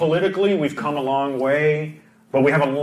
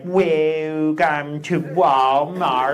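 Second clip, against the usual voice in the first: slow, drawn-out sarcastic welcome; imitating a sarcastic Vietnamese greeter; unwelcoming mock greeter, slow and dry; sarcastic greeting; much slower than usual. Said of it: "Vietnamese people sound a little sarcastic".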